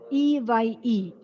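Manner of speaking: E Y E spelled letter by letter, slowly; spelling out letters. Shown as a demonstration. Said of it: "How do we pronounce that?".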